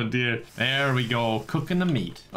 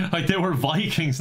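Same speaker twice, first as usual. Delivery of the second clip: ordinary talk, nothing marked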